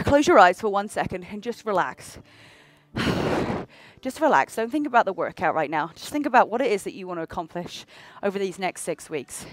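low, calm meditation voice; guided relaxation. Acted. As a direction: guide them into stillness. Leads a short relaxation.

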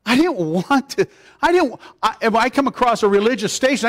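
usual speaking voice

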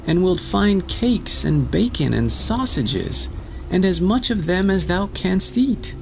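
high, coaxing little voice; tiny boy voice. Acted. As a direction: sweet-talk the wolf in a tiny voice. Tom tempts wolf.

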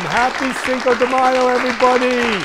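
high, loud holiday cheer; whooping greeting to the whole crowd, stretched at the end; excited party shout. Real, not acted. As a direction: shout it out like you're dancing along.

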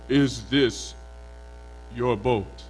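low, measured spirit voice; river spirit. A narrator's reading. Spirit offers a boat to the fisherman, low spirit voice. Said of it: "the spirit says".

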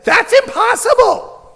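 high, loud, drawn-out mock shout; mock yelled objection. People thirty years ago rejecting the teacher's idea, high mock shout. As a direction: yell it slowly, imitating the doubters. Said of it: "the people who thirty years ago said".

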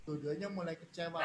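ordinary talk, nothing marked

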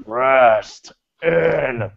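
slow, low, ominous wrestler voice; Undertaker. Acted. Wrestler pronouncing doom, slow and fading low. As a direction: intone each word long, sinking to a hush. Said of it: "'Cause here's my Undertaker".

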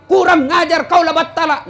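high, loud mock insult; wife yelling back at her angry husband. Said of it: "Ibu juga marah".

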